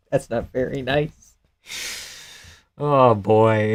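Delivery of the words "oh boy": ordinary talk, nothing marked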